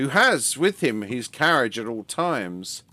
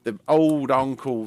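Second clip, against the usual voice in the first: ordinary delivery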